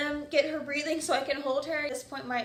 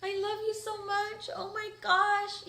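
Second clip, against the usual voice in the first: tearful tender; 8 semitones higher, slower than usual. Retold or imitated. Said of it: "I'm, like, crying".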